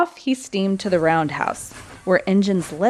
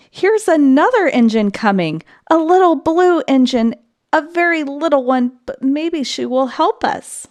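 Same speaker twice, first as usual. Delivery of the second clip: higher, louder hopeful call; clown calling out; 7 semitones higher, 4 dB louder than usual. A narrator's reading. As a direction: shout to the toys excitedly. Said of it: "the little clown called out".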